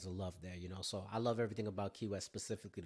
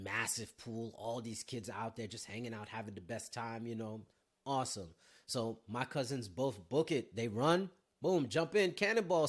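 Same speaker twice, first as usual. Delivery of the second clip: ordinary talk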